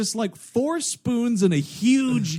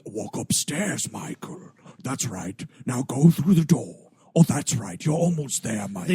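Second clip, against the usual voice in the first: scary villain voice; 5 semitones lower than usual. Retold or imitated. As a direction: croon orders like a sinister host. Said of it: "I keep having to break my scary voice".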